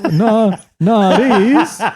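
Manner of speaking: high, loud, disbelieving protest; hammed-up outraged disbelief; shocked denial. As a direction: refuse to believe it.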